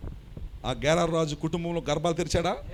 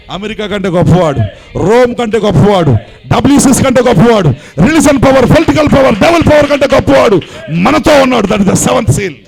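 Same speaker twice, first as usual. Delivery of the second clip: louder and higher with each line, ending in a shout; building sermon fervor; 5 semitones higher, 17 dB louder than usual. Real, not acted. Pastor to his flock, building to fervent preaching. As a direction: stack the claims, climbing to a roar.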